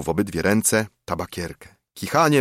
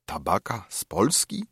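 half-hushed; 6 dB quieter than usual. A narrator's reading. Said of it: "na pół ciche słowa powtarzano".

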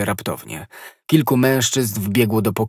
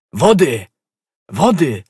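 loud, higher shout; shouting for water; 6 semitones higher, 7 dB louder, slower than usual. A narrator's reading. D'Artagnan shouts for water for fainting Konstancja, loud. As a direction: bark for water in panic. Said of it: "krzyknął D'Artagnan".

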